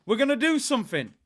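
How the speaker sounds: high, hopeful excitement; excited. Retold or imitated. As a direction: get your hopes right up. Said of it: "so I'm thinking".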